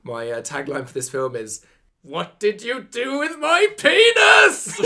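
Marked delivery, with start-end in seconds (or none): 2.0-4.9 high, slow, laughing yell; laughing shout. Acted. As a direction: belt it out through laughter.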